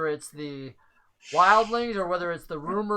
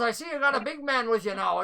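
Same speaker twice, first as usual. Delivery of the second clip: NPC voice; 4 semitones higher, faster than usual. Acted. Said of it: "he goes".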